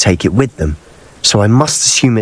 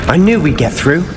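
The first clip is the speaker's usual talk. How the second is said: higher, bright triumphant cheer; thrilled praise for a friend; delighted; 7 semitones higher than usual. Acted. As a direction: celebrate the breakthrough. Puzzle solved, companion cheers.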